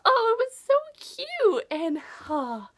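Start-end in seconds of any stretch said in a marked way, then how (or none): 0.0-1.6 slow, much higher and louder swoon; a reader swooning over a cute scene; gushing. Real, not acted. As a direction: stretch it out in delight.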